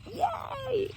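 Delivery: high squealed yay; a delighted little cheer; excited cheer. Retold or imitated. Cheering at Trump news.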